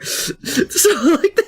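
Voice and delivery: laughing. Real, not acted. Laughing through explanation.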